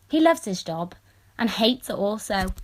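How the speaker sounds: ordinary delivery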